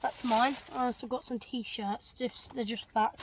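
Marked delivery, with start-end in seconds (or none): none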